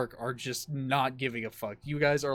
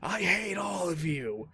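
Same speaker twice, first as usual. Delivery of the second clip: high, drawn-out mock scream; stretched-out scream, held back in level; retold scream; 5 semitones higher, slower than usual. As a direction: imitate a meltdown without full volume. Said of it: "he just screams".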